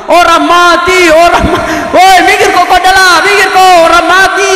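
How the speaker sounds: high, loud rebuke at the reckless riders; scolding shout. Acted. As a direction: yell at the boys to use their heads. Menegur pemuda pengantar jenazah. (teguran keras dengan suara tinggi)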